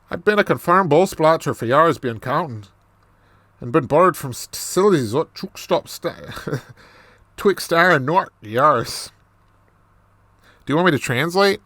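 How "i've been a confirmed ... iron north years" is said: put-on Irish brogue; Irish accent. Reader repeating the dialect opening, Irish accent. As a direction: garble it quickly in mock Irish. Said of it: "more of this Irish accent".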